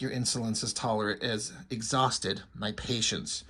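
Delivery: low, soft, menacing villain voice; deep imperious commander, quietly threatening; Darth Vader. Acted. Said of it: "he's very Darth Vader".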